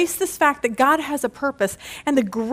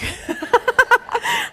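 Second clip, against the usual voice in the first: laugh; 11 semitones higher than usual. Real, not acted. Pastor laughs at Dan's joke.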